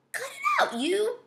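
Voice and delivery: very high, strained mock yell; mock shout. A narrator's reading. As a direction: protest in a squeal without much volume. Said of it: "Alex shouted a lot".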